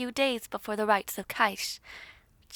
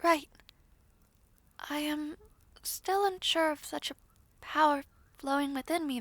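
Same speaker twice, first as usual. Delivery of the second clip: ordinary talk, nothing marked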